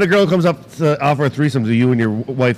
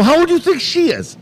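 much higher, pointed retold question; challenging question; 12 semitones higher than usual. Husband asking client to guess his wife's age, challenging. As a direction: ask it high and challenging.

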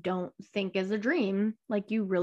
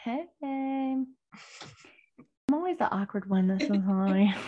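ordinary talk

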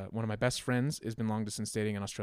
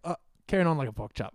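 loud, higher mock Aussie on the edge of laughing; horrible Australian accent; 5 semitones higher, 6 dB louder than usual. Acting out a favorite phrase for friends, bad Australian accent. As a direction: answer in a badly faked Australian accent. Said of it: "a horrible Australian accent".

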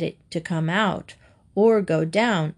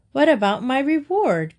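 higher, louder call; 6 semitones higher, 3 dB louder than usual. A narrator's reading. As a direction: call after him, indignant. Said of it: "called the crane".

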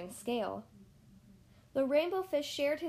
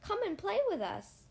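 higher, calling out to a friend; friendly call to play; 8 semitones higher than usual. A narrator's reading. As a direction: invite him to come play. Fish friends invite Rainbow Fish.

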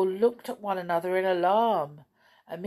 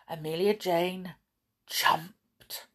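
ordinary delivery, nothing marked

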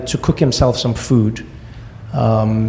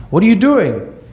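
high-pitched, indignant demand; imitated outrage, voice jumping up; indignant mother; 8 semitones higher than usual. Mother to the man who hit her, indignant.

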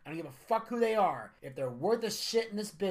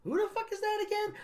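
high squeaky mock confusion; much higher, incredulous fan voice; mock baffled fan.

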